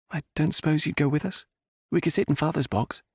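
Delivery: put-on high, gently pleading young woman; young lady's voice. A narrator's reading. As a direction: ask sweetly, hoping he agrees. Jenna pleading with Stuart.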